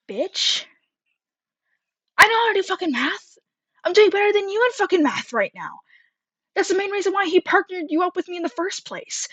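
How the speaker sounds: high, fast exasperated retort, lightly voiced; retold snapping back. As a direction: fire back like you're fed up. Narrator's retort to classmate.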